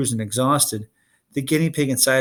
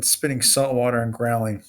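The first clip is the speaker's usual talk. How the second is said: plain reading voice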